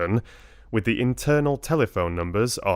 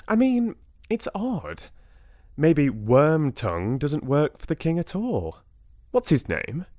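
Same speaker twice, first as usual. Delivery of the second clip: incredulous; 6 semitones higher than usual. Acted. Lottie, suspicious, outraged.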